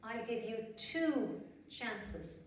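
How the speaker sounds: each word drawn out slowly; slow stern warning. Acted. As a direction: warn the bunny slowly. Fairy warns very slowly.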